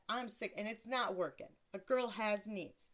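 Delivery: ordinary talk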